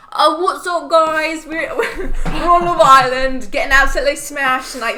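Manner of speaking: put-on loud, high British reality-show accent; hammed-up British party accent, breaking into laughs; Love Island contestant accent. Podcaster imitating a Love Island contestant, brash British accent. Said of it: "Here's my impression of someone on Love Island".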